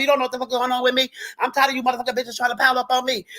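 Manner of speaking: hammed-up fast griping, words tumbling together; mock rant. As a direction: rattle it off in one breathless stream. Mock rant about someone coming after her.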